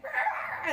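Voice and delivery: high, loud imitated roar; high-pitched mock-angry "rah"; mad child's growl. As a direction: mimic an angry kid's wordless outburst. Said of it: "she started going like".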